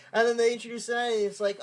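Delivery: usual speaking voice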